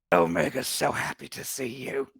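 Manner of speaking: husky, menacing creature greeting; gnarled monster voice. Acted. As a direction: speak as the twisted creature in the hole. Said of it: "slowly raise its head".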